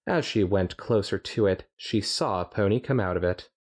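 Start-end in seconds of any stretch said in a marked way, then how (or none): none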